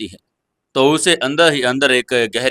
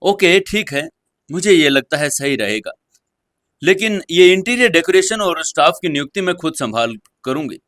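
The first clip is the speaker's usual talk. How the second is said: quiet but firm; 5 semitones higher than usual. Acted. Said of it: "धीमी लेकिन निश्चित आवाज में कहा".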